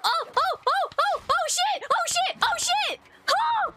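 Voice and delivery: high, rapid-fire yelps; real startled, breathless outburst; frantic excitement. Real, not acted. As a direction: squeal it over and over as the action hits. Excited panic at the action.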